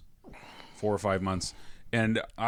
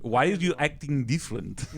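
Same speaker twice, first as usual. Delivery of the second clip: put-on failed Russian accent; 3 semitones higher than usual. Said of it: "I can't do a Russian accent".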